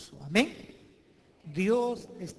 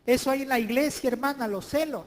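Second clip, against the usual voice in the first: louder and higher, iglesia rising; emphatic repeat; 5 semitones higher, 8 dB louder than usual. Real, not acted. Preacher hammering the point to the church, louder. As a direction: drive home "iglesia" with a lift.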